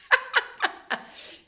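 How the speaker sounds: high airy burst of laughter; breathy laugh. Real, not acted. Host laughing at her craving memories.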